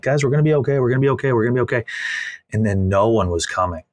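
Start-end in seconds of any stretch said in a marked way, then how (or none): 0.0-1.8 higher, bright pep-talk reassurance; rally the staff like a cheerleader; upbeat cheerleader. Retold or imitated. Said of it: "trying to just be cheerleader".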